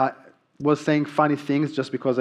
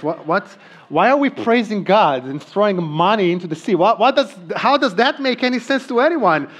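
baffled disbelief; 6 semitones higher, 4 dB louder than usual. Retold or imitated. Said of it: "And I'm like".

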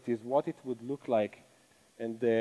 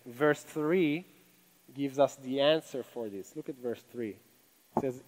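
ordinary talk, nothing marked

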